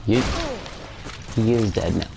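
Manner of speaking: ordinary talk, nothing marked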